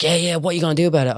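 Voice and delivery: high, fast mock taunt; cocky guy. High guy taunting the speaker at the club, fast mock taunt. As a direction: rattle it off like a jumpy aggressor.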